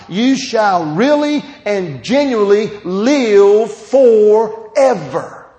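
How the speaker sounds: loud, high full-force preaching, slowing at the end. Acted. Preacher rereading the verse to his flock at full force. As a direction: drive the verse home, stretching forever.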